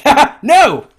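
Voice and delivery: high, loud "ha, ha" then a punched no; hammed-up, high-pitched flat-out refusal; mock-laughing refusal. Voices his scornful refusal.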